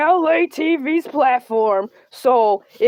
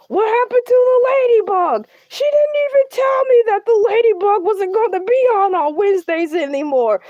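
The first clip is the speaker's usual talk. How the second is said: outraged shout; 6 semitones higher than usual. Acted. Outraged the Ladybug is gone.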